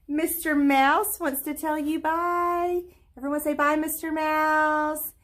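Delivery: high, drawn-out goodbye; sing-song farewell. Real, not acted. Teacher leading pupils in goodbye to Mister Mouse, high sing-song.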